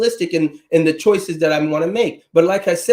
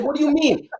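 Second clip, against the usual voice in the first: put-on higher, quoting mom's Trinidad accent; mother's Trinidadian accent; 7 semitones higher than usual. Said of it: "In her, in her accent".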